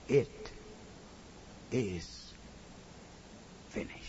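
low, soft, slow final words; hushed close. Acted. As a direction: let it settle softly. Quiet closing of sermon.

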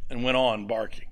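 ordinary talk, nothing marked

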